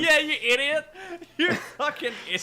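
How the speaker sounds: high, laugh-choked jeer; laughing mockery. Real, not acted. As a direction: jeer at a friend through real laughter. Gleefully mocking co-host's mistake.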